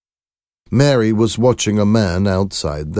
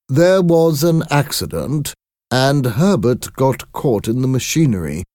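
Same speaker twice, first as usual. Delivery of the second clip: high, deliberate visitor voice; visitor breaking news; 8 semitones higher, 3 dB louder than usual. A narrator's reading. Visitor telling parents of their son's accident, high and measured. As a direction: explain the accident in a raised, formal tone. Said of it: "in a quiet voice".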